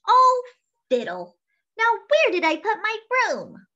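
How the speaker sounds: high, loud flustered witch; worried witch. A narrator's reading. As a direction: fret like a frazzled old witch. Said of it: "Maggie worried".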